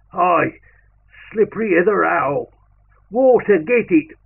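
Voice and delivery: loud, high, impatient call; yelling for water. Acted. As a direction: bark it across the camp for water.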